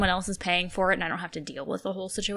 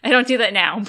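rushed; 2 semitones higher, 10 dB louder than usual. Real, not acted. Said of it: "Said that really quickly".

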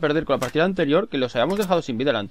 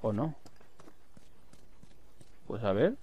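usual speaking voice

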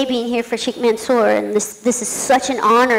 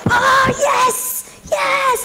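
squealed, much higher yes; inner excitement; 13 semitones higher, much slower than usual. Retold or imitated. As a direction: burst of secret glee, high and thrilled. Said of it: "like this".

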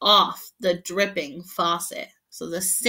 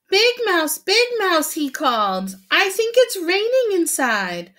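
much higher, louder alarm; alarmed calling; 11 semitones higher, 6 dB louder than usual. Acted. Little mouse alarmed it is raining inside, high call. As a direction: call out in a fright.

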